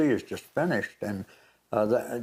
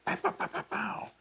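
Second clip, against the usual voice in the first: high, soft rapid-fire pows; imitated gunshots; 8 dB quieter than usual. Retelling shots heard on TV, imitated gunshots. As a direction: mimic the shots with quick, airy pops. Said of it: "you hear those shots".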